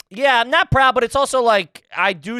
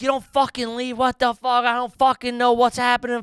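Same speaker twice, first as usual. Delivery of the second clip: higher, heated ranting; retelling yelling at wife in the car, higher; angry rant; 8 semitones higher than usual. Retold or imitated. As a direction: vent at her in frustration. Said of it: "I'm like".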